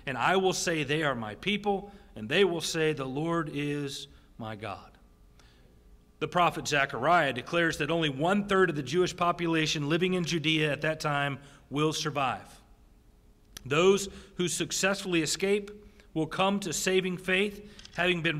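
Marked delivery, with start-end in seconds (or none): none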